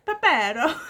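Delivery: Pepero said wrong as a laughing joke, loud and high; joking mispronunciation. Pretend. Vlogger teasing viewers with the snack's name, joking mispronunciation. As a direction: blurt "Pepero" through a laugh, tapping the r. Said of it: "I'm joking, they're not".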